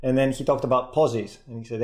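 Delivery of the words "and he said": ordinary talk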